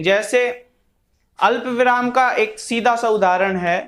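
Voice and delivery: normal talking voice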